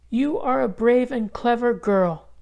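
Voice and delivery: put-on high, gentle old lady greeting; kind grandmother. A narrator's reading. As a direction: greet her granddaughter gladly. Grandmother praises Isabel.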